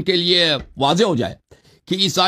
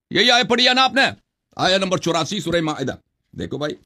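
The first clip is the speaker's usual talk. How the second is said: usual speaking voice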